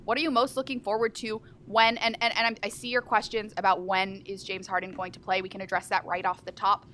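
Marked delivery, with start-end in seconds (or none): none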